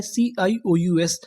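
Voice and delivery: सीआईओयूएस spelled slowly, letter by letter. Shown as a demonstration. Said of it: "जैसे यहाँ पर क्या लिखा है". (अक्षर-अक्षर धीरे बोलना)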